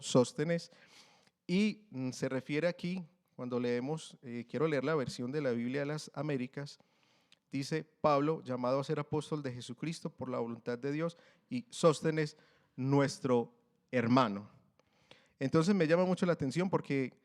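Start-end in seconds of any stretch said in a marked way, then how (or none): 0.0-0.7 Sóstenes with the stress on the first O, said louder; accented Sóstenes. Shown as a demonstration. Preacher shows the church how to say Sóstenes, stressing the first O. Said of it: "es con tilde en la O". 7.8-14.5 louder Bible reading, slowing at the end; scripture reading. Acted. Preacher reads the Américas version to the church, louder.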